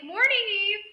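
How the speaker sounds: an unsettling chipper greeting from the intruder; creepy cheery host. Retold or imitated. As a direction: greet her sweetly, too sweetly. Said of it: "he's just like".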